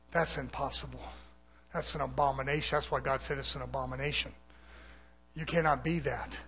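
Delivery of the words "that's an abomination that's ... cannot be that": ordinary delivery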